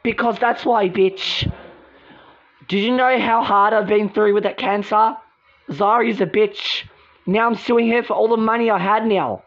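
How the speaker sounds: ordinary talk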